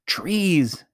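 sudden high amazed cry; exclaiming. A narrator's reading. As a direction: burst out with wonder. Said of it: "he exclaimed".

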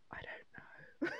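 hushed mock-clueless whisper; whispered reply. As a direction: whisper it like a baffled child. Retold daughter's bewildered answer.

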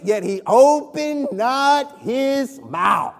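loud, high, stretched scripture proclamation; full-force preaching. Real, not acted. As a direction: preach the verse out, leaning hard on key words.

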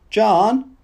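high, coaxing call with rising tune; small ingratiating voice. A narrator's reading. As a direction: call out tentatively, wheedling. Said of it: "small, ingratiating voice from the bathroom".

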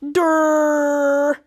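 long, loud dopey durr; mocking durr. Charlotte answers Henry's late realization, mocking durr. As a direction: stretch it out to mock slowness.

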